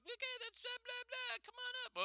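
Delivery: very high falsetto worker rattling it off; mock cashier voice. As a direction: squeak it like a bored cashier. Retold drive-thru speaker voice.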